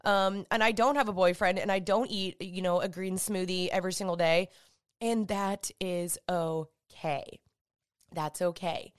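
ordinary delivery

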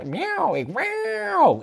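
high, drawn-out cat yowl; cat meowing. Acted. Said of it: "porque Floyd es un gato". (maullido agudo de gato)

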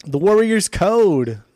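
loud, slow and proud; excited. Real, not acted. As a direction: announce the title with gusto. Excited naming favorite album.

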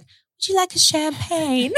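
high, slow, polite offer; posh hostess voice. Retold or imitated. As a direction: put on a high sweet hostess voice. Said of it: "the lady's like".

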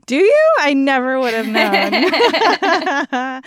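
teasing high mock surprise, laughing; sarcastic. Real, not acted. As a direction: tease her, drawing it out.

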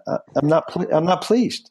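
ordinary talk, nothing marked

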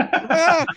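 sudden high loud yelp; startled yelp. Retold or imitated. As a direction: shriek like you got burned. Shocked by the porn handoff.

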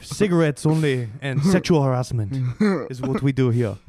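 playing a snooty Frenchman; French accent. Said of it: "my French accent, very bad".

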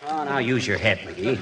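ordinary talk, nothing marked

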